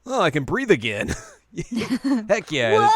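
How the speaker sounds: high, loud laughing relief; relieved cheer. Retold or imitated. Imitating Shippo waking up able to breathe, mock relieved cheer. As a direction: burst into hammed-up joy. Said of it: "Shippo wakes up and is like".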